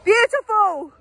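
high, loud delighted cry; excited shout. Real, not acted. Cheering the landing.

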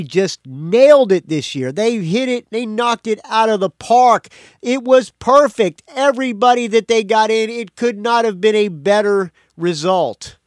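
high, loud, emphatic mock raving; mock gushing. Podcaster imitating radio hosts falling over themselves about their picks, mock gushing. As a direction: gush in an exaggerated, over-the-top triumph. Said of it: "falling over themselves about how they".

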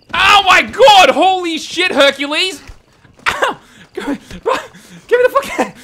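real panicked yell, loud and high; startled shout. Real, not acted. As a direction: blurt it out in genuine shock. Startled by attacker in game.

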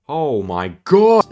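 slow, high, loud exclamation; shocked. Real, not acted. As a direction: draw it out in loud disbelief. Shocked reaction to the game.

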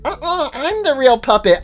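high, insistent puppet voice; contradicting puppet. Acted.

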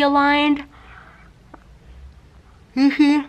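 talking with stretched lips; lips pulled by fingers. Real, not acted. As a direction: mouth held wide open. Seen 5: Fingers pull lower lip down, showing braces, from 5.4-9.0s.

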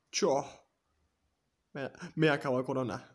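loud victory cry; excited shout. Real, not acted. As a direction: yell it out after winning. Just won the round. (grito de victoria)

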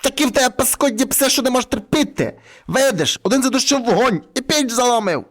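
furious granny telling someone off; angry old woman. A narrator's reading. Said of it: "баба сердита аж мало не трісне".

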